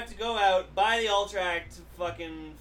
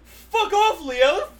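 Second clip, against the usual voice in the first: mock yelling; 13 semitones higher, 7 dB louder than usual. Mock Trailer Park insults.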